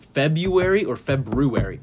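February first as FEB-you-ary, then FEB-roo-ary; slow February both ways, second with the R; two pronunciations. Shown as a demonstration. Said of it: "But you spell it Ruary".

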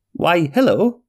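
bright, lifted hello to a stranger; enthusiastic greeting. Acted. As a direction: greet him eagerly, voice jumping up. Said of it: "replied the man enthusiastically".

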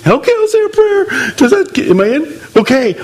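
high jittery laughing plea; mock frightened convert. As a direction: play a scared, eager newcomer.

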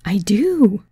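eager, lifted, drawn-out agreement; light up with a touch of excitement; mildly excited. A narrator's reading. Guest sold on the massage idea, mildly excited. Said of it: "she said excitedly".

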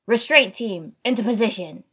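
loud battlefield order; shouted command. A narrator's reading. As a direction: call the team into position, loud. Team leader calls out orders.

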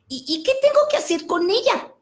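put-on a kid who doesn't know what to do; puzzled little boy. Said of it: "boy asks the blot".

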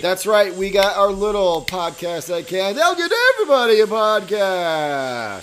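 big show-opening voice; hyped podcast intro. Acted.

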